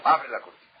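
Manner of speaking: loud firm order, open the curtain; the rabbi orders the young man loudly; commanding. Retold or imitated. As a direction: bark it out like a stern rabbi.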